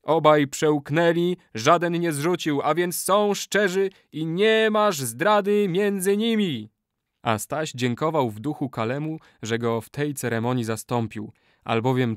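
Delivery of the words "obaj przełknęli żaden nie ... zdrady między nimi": high, slow, celebratory cry; joyful shouting. A narrator's reading. Warriors celebrate the sworn brotherhood, joyful shouting. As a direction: proclaim it out loud with joy. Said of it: "poczęli wołać z radością".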